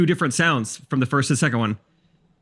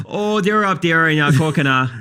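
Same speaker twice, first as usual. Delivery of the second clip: put-on slow, higher-pitched upper-Midwest accent; drawn-out mock Minnesota accent; 3 semitones higher, slower than usual. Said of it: "That accent is Minnesota".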